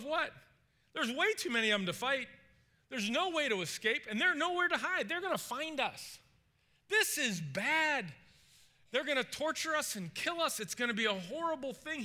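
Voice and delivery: high-pitched acted panic; freaking out. Servant seeing the army surround them, freaking out. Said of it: "He's freaking out".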